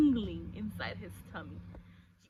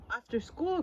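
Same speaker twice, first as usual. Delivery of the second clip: ordinary delivery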